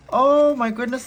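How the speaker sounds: gasp, then a loud high oh; stunned by how it turned out; amazed gasp. Real, not acted. Sees the result.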